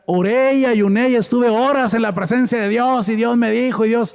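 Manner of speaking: high-pitched mock-pious proclamation; pious boasting. Mimics a falsely spiritual boaster. (imitando a un devoto presumido)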